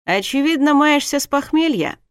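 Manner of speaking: loud, high, gleeful tease; amused. A narrator's reading. Husband teases his hungover wife, amused. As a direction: rub it in cheerfully. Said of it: "развеселился змей".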